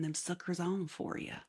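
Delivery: slipping lightly into a Southern US accent; soft, casual Southern US lilt. Real, not acted. Host showing off painted eyebrows to viewers, light Southern accent. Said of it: "the Southern accent that I do".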